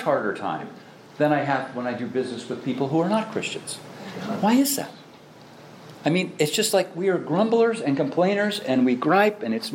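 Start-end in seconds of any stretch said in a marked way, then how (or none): 4.4-4.9 exasperated question. Real, not acted. Preacher venting frustration.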